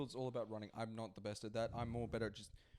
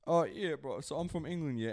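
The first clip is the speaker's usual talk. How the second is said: attempted English accent, casual; 4 dB louder than usual. Shown as a demonstration. Friend showing how his impression begins, English accent. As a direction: start out in a put-on English accent. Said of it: "trying to do an English accent".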